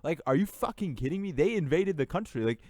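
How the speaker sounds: exasperated at Western pressure, getting angry; heated rant. Real, not acted. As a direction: rail against it, voice rising. Heated rant about Russia's invasion.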